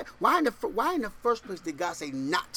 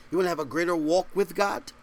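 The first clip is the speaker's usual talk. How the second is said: ordinary talk, nothing marked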